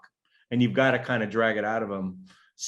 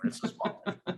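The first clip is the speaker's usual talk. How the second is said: much softer, breathy with a laugh; laughing whispered aside; 8 semitones higher, 10 dB quieter than usual. Real, not acted. Teasing the Canadian guest, whispered through a laugh. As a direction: slip the joke in under your breath.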